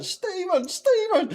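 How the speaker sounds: high, sing-song call across the yard. Retold or imitated. Woman leaning out her back door calling her horse, high call.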